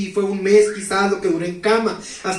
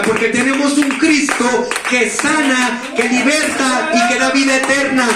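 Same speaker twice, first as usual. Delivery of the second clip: preaching shout; 4 semitones higher than usual. Real, not acted. Testifying to the congregation, building to a fervent shout. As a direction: proclaim it to the church with full force.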